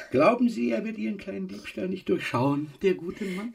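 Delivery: high, sneering sing-song threat; mock her with the husband; mocking taunt. Moneylender taunting Emma about her husband, high sneering voice.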